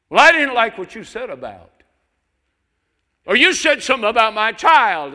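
shrill, high, loud whiny parishioner; mocking complainer. Preacher mimics a parishioner scolding the pastor, high and shrill. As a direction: lampoon an indignant churchgoer. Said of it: "how some people disrespectfully speak to their pastor".

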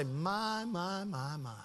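very soft, drawn-out murmur; murmured listening. Retold or imitated. Pastor responds to a man's troubles, soft murmur. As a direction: voice absent-minded sympathy under the breath.

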